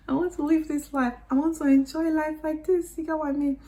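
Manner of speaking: high, gushing longing; high excited voice. Retold or imitated. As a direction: gush like a starstruck girl. Dreamy repeat of wish.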